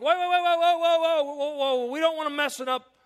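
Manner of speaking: high, loud alarmed "whoa whoa"; fussing disciples. Retold or imitated. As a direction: flap like a nervous church usher. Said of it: "And the disciples like".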